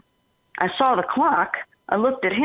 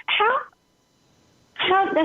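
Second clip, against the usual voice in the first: much higher, incredulous "how"; astonished retold question; 14 semitones higher, slower than usual. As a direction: imitate his disbelief, pitch jumping up. Roommate's astonished question in retold talk.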